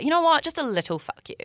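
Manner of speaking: cheeky put-down, tossed off lightly at the end; playfully pissed off. Retold or imitated. Diffusing annoyance with people as a joke, playfully pissed off. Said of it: "but in a funny way".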